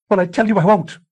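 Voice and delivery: high, loud refusal; defiant shout. A narrator's reading. A wife shouting her refusal at her husband. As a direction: fling it back at him.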